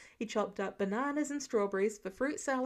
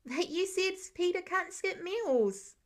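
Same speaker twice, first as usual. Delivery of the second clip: put-on arguing child; 7 semitones higher than usual. Child argues with father.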